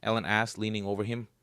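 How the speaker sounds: ordinary delivery, nothing marked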